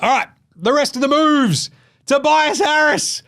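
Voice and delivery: excited roll-call; hyped announcement. Real, not acted. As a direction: announce the big name with a high, stretched flourish. Hyping the next trade announcement.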